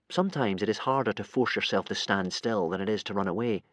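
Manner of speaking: ordinary delivery, nothing marked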